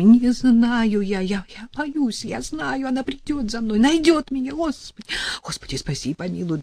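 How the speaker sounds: pleading with God in terror; frightened pleading. Acted. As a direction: prophet babbling in panic, high and tearful. Terrified prophet begs, near tears.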